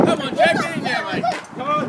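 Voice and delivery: shouting encouragement. Real, not acted. Cheering on the next dunk.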